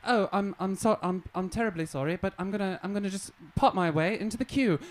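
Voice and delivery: imitating an overly polite Brit; polite British. Comic to American crowd, mock British apology. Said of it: "I thought that it was gonna be more like".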